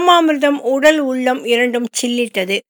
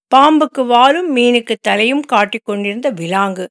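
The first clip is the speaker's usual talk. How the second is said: ordinary delivery, nothing marked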